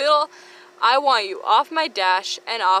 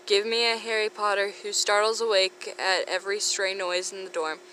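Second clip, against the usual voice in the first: ordinary delivery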